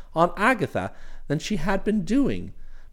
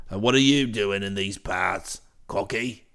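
much lower, hearty brash chap; cheery voice; 12 semitones lower than usual. Acted. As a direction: hail him with cheery familiarity. Bingley voiced again.

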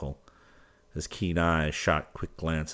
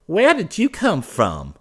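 put-on much higher, slow young man's demand; 13 semitones higher, 8 dB louder, slower than usual. A narrator's reading. Wally confronts the masked man, demanding. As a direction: bark the question at the stranger. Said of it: "he demanded".

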